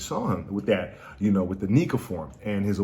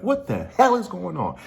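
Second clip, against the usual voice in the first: high-pitched mock shock; 10 semitones higher than usual. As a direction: exclaim in cartoonish disbelief. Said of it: "the same shocked reactions".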